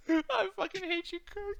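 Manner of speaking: normal talking voice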